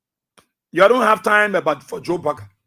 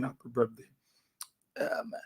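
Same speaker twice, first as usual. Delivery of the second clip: almost whispered, much softer; hushed birthday wish; 5 semitones lower, 17 dB quieter than usual. Retold or imitated. Father relays the siblings' wish to his son in a hush. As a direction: breathe the wish out quietly.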